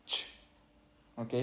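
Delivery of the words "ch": "ch" as a bare Spanish affricate, no vowel; CH sound. Shown as a demonstration. Teacher naming the sound French lacks, bare "ch". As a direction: sound out "ch" like a short sneeze. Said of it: "En francés no vamos a tener".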